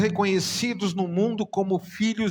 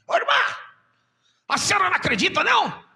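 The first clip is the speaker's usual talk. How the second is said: much higher, louder shouted Enéas-style challenge; Enéas impression; 12 semitones higher, 6 dB louder, much faster than usual. Pastor Garzoli confronting the doubting sister, shouting as Enéas. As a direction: bellow it like Enéas on the phone. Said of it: "Ele parecia o Enéas falando".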